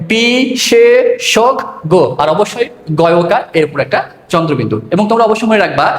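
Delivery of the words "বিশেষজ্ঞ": বিশেষজ্ঞ as bisheshoggo, drawn out very slowly; standard pronunciation. Shown as a demonstration. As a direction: stretch বিশেষজ্ঞ clearly. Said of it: "প্রমিত উচ্চারণ কি হবে?". (প্রমিত উচ্চারণে টেনে বিশেষজ্ঞ)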